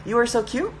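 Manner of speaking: "You are so cute" pitched high, rising on "cute"; high questioning lift on "cute"; questioning tune. Shown as a demonstration. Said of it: "question mark".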